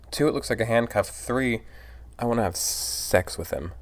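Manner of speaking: ordinary talk, nothing marked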